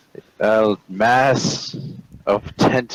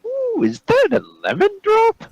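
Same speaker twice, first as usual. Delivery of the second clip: put-on much higher and fast, childlike; high squeaky voice; faster than usual. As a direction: switch to a tiny delighted squeak. Sudden sweet greedy switch.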